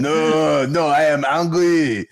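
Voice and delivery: put-on drawn-out, exasperated French accent; thick French accent. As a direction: imitate a Frenchman not being understood. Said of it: "he was thick with it".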